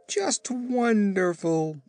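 high, slow, drawn-out admiration; admiring echo. A narrator's reading. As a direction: marvel at the joke as the chief. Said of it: "echoed the Chief".

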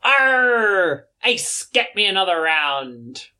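high hammed-up pirate growl; pirate salamander. Salamander calling to crewmate for drinks, pirate voice. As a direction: bellow it like a tipsy pirate captain.

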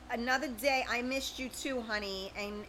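normal talking voice

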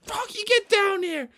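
very high, hissed squeal; strained angry order. Acted. Dad grabs Froggy on stage, strained furious order. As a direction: force it out through gritted teeth.

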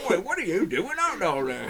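high, loud Cockney voice; hammed-up Cockney shout, pitched high; Cockney accent. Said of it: "I was gonna try to do a Cockney accent".

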